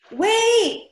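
sudden high cry of surprise; surprised shout. Real, not acted. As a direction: stop everything, it just clicked. Realizes who her friend is.

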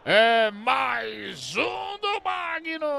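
long, stretched, high goal yell; explosive goal commentary. Real, not acted. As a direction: hold the goal cry out long. Goal call. (narração de gol explosiva)

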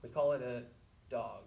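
ordinary talk

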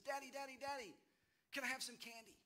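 put-on high, eager kid voice pleading for candy; begging little boy. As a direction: play a five-year-old tugging at dad. Child begging father for candy.

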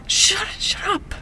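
slow, breathless hushing whisper; urgent whisper. Acted. Barista hushing her friend so the manager won't hear, whispered. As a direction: hiss it so the boss can't hear. Manager is behind the kitchen door.

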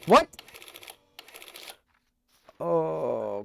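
usual speaking voice

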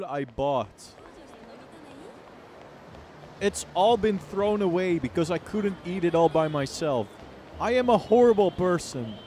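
loud, high YouTuber greeting; hyped YouTuber. Pretend. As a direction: hype up the viewers.

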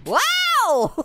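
high, thrilled cry of wow; excited wow. Real, not acted. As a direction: sell the amazement. Gets reaction in game.

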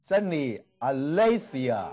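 aletheia said syllable by syllable: a-le-the-ia; slow pronunciation demo. Shown as a demonstration. Teacher modelling a Greek word for students, slowly. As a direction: spell aletheia out slowly in sound. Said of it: "真理叫aletheia啊".

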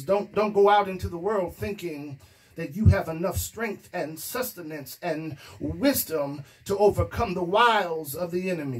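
ordinary talk

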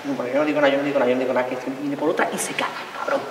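low, soft muttered griping; grumbling to herself. Acted. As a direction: grumble under your breath. Muttered grumble while waiting.

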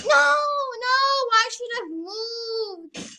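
high gasp and frantic no; panicked. Real, not acted. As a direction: panic as you're caught. Caught by killer.

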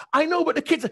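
very high, eager protest; high-pitched giddy interruption; excited. Retold or imitated. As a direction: squeak out an excited objection.

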